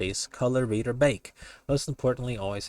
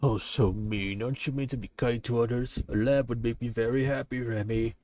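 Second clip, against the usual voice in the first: hammed-up sulky wolf; whining wolf; 2 semitones higher than usual. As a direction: pout and wheedle as the wolf. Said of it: "Dramatic reading".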